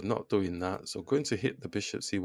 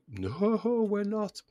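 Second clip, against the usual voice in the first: sudden high squeaky correction; narration mode; 13 semitones higher, 6 dB louder, much slower than usual. Real, not acted. Chess streamer correcting himself in a high squeak. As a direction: jump way up in pitch to take it back. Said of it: "Getting into narration mode".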